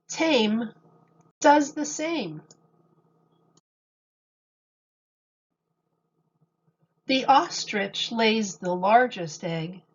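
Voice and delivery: gentle sing-song picture book reading; storybook voice for kids; reading to children. Acted. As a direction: read the rhymes to a little audience. Reads picture book aloud to children.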